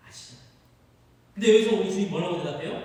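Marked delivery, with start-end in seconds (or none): 0.1-0.5 much softer, almost breathed; Peter's last guilty answer, very soft; ashamed hush. Acted. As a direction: let it fade out in shame. Peter repeats.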